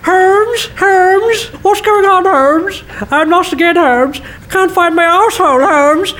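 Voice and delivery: mocking whiny friend. Mocks friend's whining Holmes habit.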